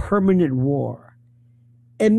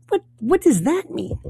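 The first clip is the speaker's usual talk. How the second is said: high, incredulous squeak of confusion; baffled disbelief; 10 semitones higher than usual. Retold or imitated. Patient baffled by the doctor's odd comfort, incredulous. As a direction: sound truly lost at what the doctor said.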